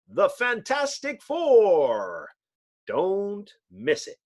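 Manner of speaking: slow, grand announcer read; announcer sign-off. Acted. Narrator promoting the next issue, grand slow. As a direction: stretch it out like a serial promo.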